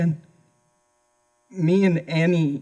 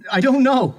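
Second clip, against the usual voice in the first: defensive; 8 semitones higher, 4 dB louder than usual. Acted. Suspect pressed by interrogator.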